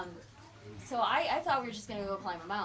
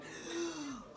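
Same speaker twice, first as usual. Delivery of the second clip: gasp; 8 semitones higher, 4 dB quieter than usual. Retold or imitated. Justin sees her near cliff.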